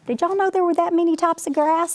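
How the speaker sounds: high, fast and eager; excited question. Real, not acted. Asks listening children excitedly.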